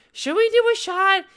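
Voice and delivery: high, loud, sudden whoop; excited proposal. Real, not acted. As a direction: propose it like a party cheer. Proposes a celebratory shot.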